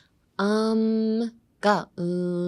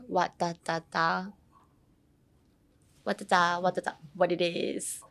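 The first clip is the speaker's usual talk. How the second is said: 'what da da da' tripping evenly into 'what it is'; song-like intonation; 5 dB quieter than usual. Shown as a demonstration. Teacher borrowing a K-pop hook, rhythmic 'what it is'. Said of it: "has the same intonation".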